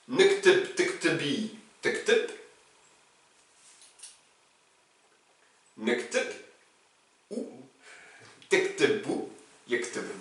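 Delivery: quiet Moroccan feminine forms, "taktubi" as tektebi; Moroccan Arabic, feminine. Shown as a demonstration. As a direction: softly recite the feminine forms with Moroccan vowels. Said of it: "Si je suis une fille, à la marocaine".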